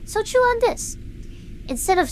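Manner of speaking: bursting to share the idea; eager pitch. Acted. Pitching a big new idea.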